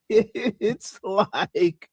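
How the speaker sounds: high laughter spilling into the words; laugh, then try to talk through it. Real, not acted. Cracking up at own Hoodservative joke, laughing.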